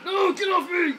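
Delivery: high, loud desperate yells; panicked shouting. Real, not acted. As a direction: yell while being strangled. Being grabbed by zombie.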